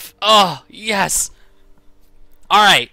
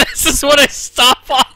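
high squeaky laugh-talk; laughing so hard it's crying; 11 semitones higher, 7 dB louder than usual. Real, not acted. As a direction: barely get words out laughing.

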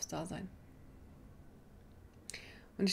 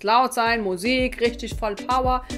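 louder, pumped-up gym enthusiasm; loud excited; 3 semitones higher, 9 dB louder, slower than usual. Retold or imitated. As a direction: shout it like loud workout music. Mimics her old gym attitude.

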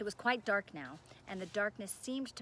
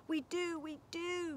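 high, eager moan; 10 semitones higher than usual. A narrator's reading. Gargoyle brothers pleading to be freed of the spell, moaning. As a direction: wail it in a high plaintive voice. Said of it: "moaned the brothers".

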